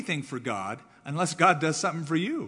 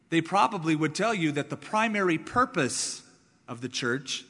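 ordinary talk, nothing marked

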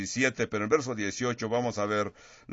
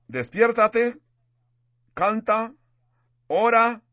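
rousing call; 10 semitones higher, 4 dB louder, slower than usual. Acted. Preacher proclaiming church slogan.

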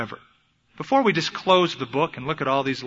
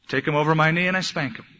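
ordinary delivery

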